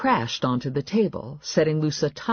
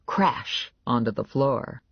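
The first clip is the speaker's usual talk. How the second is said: usual speaking voice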